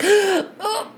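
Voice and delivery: high startled oh; startled gasp. Retold or imitated. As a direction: gasp it out in alarm. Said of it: "you're like, gasps".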